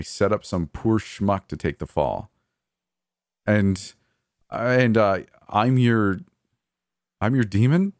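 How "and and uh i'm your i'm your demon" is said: halting stammer climbing to a question; higher, faltering disbelief; dawning dread. A narrator's reading. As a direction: piece it together haltingly, appalled. Dawning horrified realisation, stammering.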